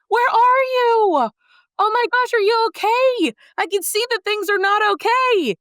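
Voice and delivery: high-pitched, stretched-out anxious calling; frantic parent. Acted. God calling to people who disappeared, frantic concern. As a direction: call out in acted panic, voice soaring.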